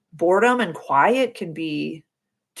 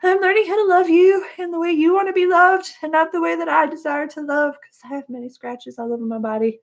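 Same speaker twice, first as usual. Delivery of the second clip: much higher, louder put-on voice to the cat; singsong to cat; 11 semitones higher, 5 dB louder than usual. Talking to her cat about loving it her way: high crooning voice. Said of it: "So I'm like".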